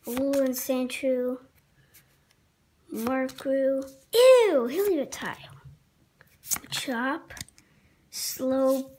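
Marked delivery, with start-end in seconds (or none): 4.1-4.7 high, loud "Ew!"; disgusted. Real, not acted. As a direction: recoil in disgust. Disgust at card.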